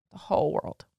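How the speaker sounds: soft, tearful, close to crying; mother choking up; holding back tears. Real, not acted. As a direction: voice giving way as she tells her son she loves him. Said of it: "without blubbering and without crying".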